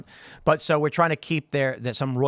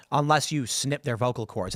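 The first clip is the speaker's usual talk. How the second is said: usual speaking voice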